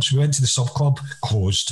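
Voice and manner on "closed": ordinary delivery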